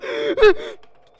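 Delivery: huge high gasp of fright; a sharp shrieking intake of breath; loud panicked gasp. Real, not acted. Panic scream in game.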